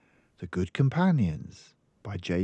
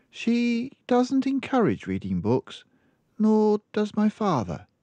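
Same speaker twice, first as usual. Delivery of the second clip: put-on much higher little girl voice; child explaining her mother's habits; small girl; 3 dB louder than usual. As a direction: speak like a matter-of-fact little girl.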